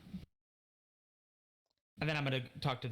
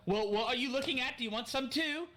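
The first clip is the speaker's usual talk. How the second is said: much higher, indignant challenge; confronting onlookers; 12 semitones higher than usual. Acted. Player's character rounds on everyone staring, indignant challenge. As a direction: call out the starers, pitched way up.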